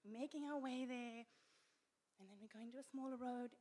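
normal talking voice

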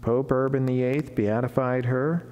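ordinary talk, nothing marked

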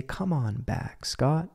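soft, slow coaxing to himself; gentle self-talk. Shown as a demonstration. Telling himself to return to the present, soft and slow. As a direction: call yourself back kindly and quietly. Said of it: "I say".

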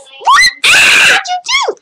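high shocked yelp; surprised shout. Real, not acted. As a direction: shriek in disbelief. Surprised outburst.

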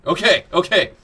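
loud breathless relief; relieved excitement. Real, not acted. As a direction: blurt it out in relief. Relief after crawling through.

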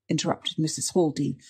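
ordinary delivery

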